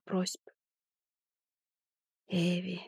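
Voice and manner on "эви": hoarse breathy exhale. Acted. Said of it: "сипло выдохнул на ухо".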